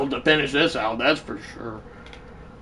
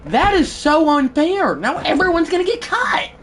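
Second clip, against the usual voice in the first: high-pitched indignant protest; mad; 9 semitones higher than usual. Real, not acted. Gamer protesting that everyone gets cut, mad. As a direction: whine it high, truly outraged. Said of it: "I am that mad right now".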